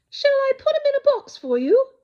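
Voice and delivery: high, bright shop lady voice; a sales lady offering to box the bear. A narrator's reading. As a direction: ask a customer politely. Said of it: "the sales lady asked".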